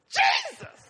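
high, loud shriek of joyful shock; hammed-up astonished scream; expressive outcry. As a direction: imitate an overjoyed woman shrieking out. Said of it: "they're more expressive".

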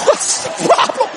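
high, breathy, strained question; mock scared question. Husband fearing a snake asks his wife, mock scared. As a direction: squeeze it out without moving. Fearful question after don't move warning.

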